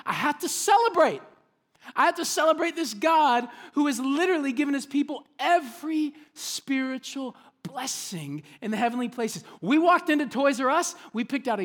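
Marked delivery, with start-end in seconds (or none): 0.1-9.4 high, loud, bursting with joy; joyful exclamation. Retold or imitated. Paul bursting to celebrate God, high and joyful. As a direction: proclaim it like you can't hold it in. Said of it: "He's saying".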